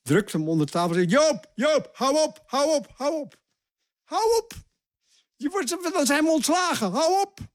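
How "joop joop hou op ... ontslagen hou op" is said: high, urgent panicked begging; frantic pleading. Retold or imitated. Colleague begging Joop to stop before they're fired, panicked. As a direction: pull him back before it's too late. Colleague pulls him under table.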